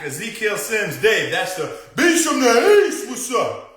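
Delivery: high, loud, hyped greetings to each viewer; excited shoutouts. Real, not acted. Host hyping up viewers on a live stream, excited high loud greetings. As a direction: fire up the chat with big hellos.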